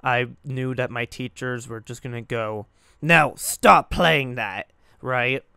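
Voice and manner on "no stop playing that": loud, drawn-out telling-off; scolding teacher. Retold or imitated. Teachers telling a pupil off for swear words, loud scolding. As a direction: imitate a fed-up teacher's loud order to stop.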